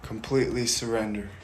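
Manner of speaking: from normal down to soft; quietly fading guidance; fading meditation. Acted. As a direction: let the voice sink into surrender.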